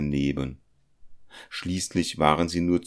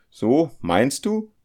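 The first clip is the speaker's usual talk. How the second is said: high, loud and drawn out, agitated; a nervous challenge, stretched slowly; agitated question; 9 semitones higher, 5 dB louder, slower than usual. Acted. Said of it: "fragte Felix aufgeregt". (aufgeregt, hoch, laut und gedehnt)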